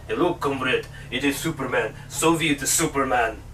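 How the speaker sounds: put-on low, soft Russian accent, comrade style; mock Russian accent. Reviewer jokingly voices Soviet Superman in a Russian accent. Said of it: "people with a Russian accent saying Superman".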